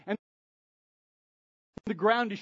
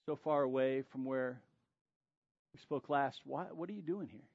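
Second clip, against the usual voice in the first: God's quiet voice; 3 semitones lower, 5 dB quieter than usual. Acted. God gently questioning Elijah hiding in the cave, hushed. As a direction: ask gently, almost under the breath. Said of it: "there came a voice to him".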